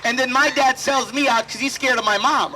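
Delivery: usual speaking voice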